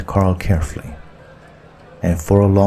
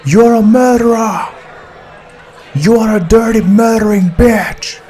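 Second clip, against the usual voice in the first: high, loud outraged yell; furious shout; 5 dB louder than usual. A narrator's reading. American passenger yells at the armed girl, furious high shout. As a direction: scream the accusation at her, much higher and louder. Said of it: "stood up and shouted at her".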